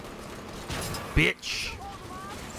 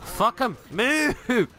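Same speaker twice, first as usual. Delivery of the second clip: urgent shouting; 9 semitones higher, much slower than usual. Real, not acted. Urging the squad on.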